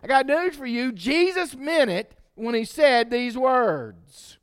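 loud, high, driving sermon voice; full-force preaching. Acted. Preacher warning the church about bitterness, loud and high. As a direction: preach it hard to the congregation.

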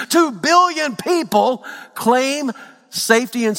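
high, loud building sermon delivery; rising preacher's cadence, pushing up in pitch; preaching at full force. Acted. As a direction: preach it out to the whole church.

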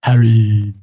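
low, loud audiobook character voice; Hermione impression. Repeating the narrator's character voice as a joke, drawn-out call. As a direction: draw the name out long, low and loud. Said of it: "Gilderoy Lockhart".

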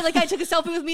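usual speaking voice

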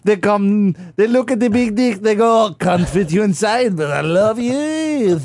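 put-on high, swooning French-accent parody; mock French accent. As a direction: croon it high like a lovesick Parisian, French accent. Acting out lovers of the Tower.

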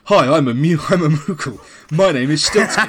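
lightly voiced cute creature, laughing; a hint of a cute little critter; cute Moogle voice. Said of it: "Moogles are gonna sound really cute".

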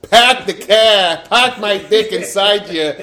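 put-on slow, drawn-out Boston accent. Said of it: "I be speaking in a Boston accent".

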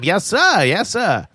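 put-on high, punchy old radio announcer; old-timey announcer. Shown as a demonstration. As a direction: snap it out like a newsreel. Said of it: "they had to say them".

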